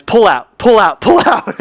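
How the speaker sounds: hammed-up urgent plea; mock urgent. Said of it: "decoded ... as".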